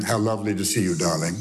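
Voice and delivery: lightly voiced film-noir mother, dropping low; mother's film-noir voice. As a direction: imitate a low film-noir darling, sinking on the last word. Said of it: "Her voice was kind of like that".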